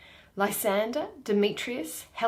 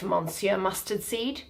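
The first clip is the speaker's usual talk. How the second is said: usual speaking voice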